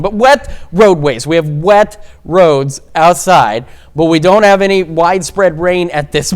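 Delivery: normal talking voice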